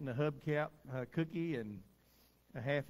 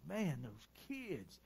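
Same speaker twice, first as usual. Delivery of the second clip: retold tearful; 7 dB quieter, slower than usual. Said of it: "She's crying".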